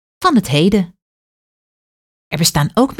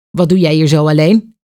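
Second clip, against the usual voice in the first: heavy voice; 5 dB louder than usual. A narrator's reading. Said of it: "vraagt een zware stem".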